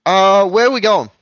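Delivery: high, loud sailor captain; captain NPC voice. Acted. Captain NPC asks crew.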